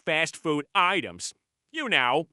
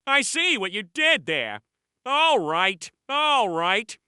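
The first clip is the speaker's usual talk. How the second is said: ordinary delivery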